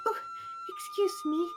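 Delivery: tiny high squeaky voice; pixie. Acted. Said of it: "a little voice over the hustle bustle".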